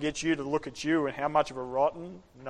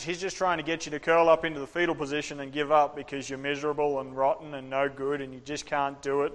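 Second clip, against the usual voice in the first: ordinary talk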